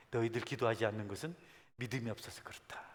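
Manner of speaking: low, soft voice sinking into a whisper; softly quoting Jesus, ending whispered; hushed quote. Acted. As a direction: let the rebuke fall to a whisper.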